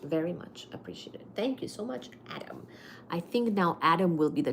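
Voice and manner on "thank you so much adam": soft unvoiced thank you; whispered thanks. Real, not acted. Streamer privately thanking a loyal viewer, whispering. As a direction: breathe the thanks out.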